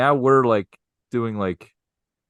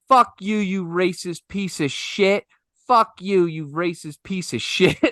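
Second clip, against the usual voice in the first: imitated furious yell; 7 semitones higher than usual. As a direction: spit it at him like an enraged drunk. Angry customer yelling at bartender.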